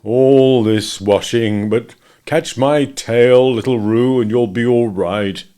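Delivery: very slow, gloomy grumble; grumbling Eeyore. A narrator's reading. Eeyore offering his tail to Roo, slow and glum. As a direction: grumble it slowly to yourself. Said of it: "grumbling quietly to himself".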